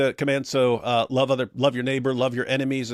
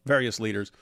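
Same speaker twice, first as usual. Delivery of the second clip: usual speaking voice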